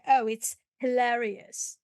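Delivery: usual speaking voice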